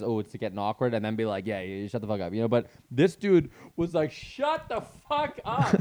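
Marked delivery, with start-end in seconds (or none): 1.5-2.3 softer, lower, offhand "shut the fuck up"; calm quiet shut-down. Shown as a demonstration. Comic showing how he'd quiet a chatty crowd, low and soft. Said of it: "just get quiet and let them realize". 4.1-5.8 much higher, slow, drawn-out "Shut the fuck up" with "fuck" stretched; mock the furious host losing it; pissed-off yell. Retelling an angry host snapping at the crowd, high mock rage. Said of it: "he was so pissed".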